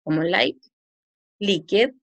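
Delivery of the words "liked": LIQUID as li-ked, every written letter sounded; read as spelled. Shown as a demonstration. Teacher spelling out for students, LIQUID read as written. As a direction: read "liked" Spanish-style, LIQUID with two syllables. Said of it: "no estoy pronunciando, estoy escribiendo".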